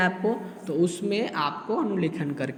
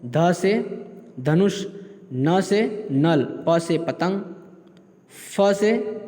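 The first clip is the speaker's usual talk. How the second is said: slow dictation; much slower than usual. Shown as a demonstration. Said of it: "teacher reading slowly".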